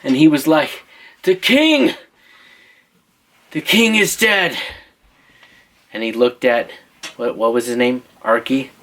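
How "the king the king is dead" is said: higher, drawn-out cry of alarm; storyteller's dramatic wail; wounded squire's cry. Acted. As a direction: bring grave news as a wounded messenger. Wounded squire cries the news.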